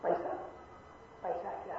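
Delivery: usual speaking voice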